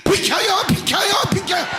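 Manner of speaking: high, loud panicked yell; yelling skier. Retold or imitated. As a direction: shout them out of the way while hurtling downhill. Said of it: "시작".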